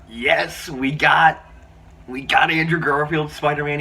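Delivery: high, loud fan celebration; excited cheer. Retold or imitated. Fan reliving the moment Spider-Man appeared, excited. Reenacts own excited cinema reaction.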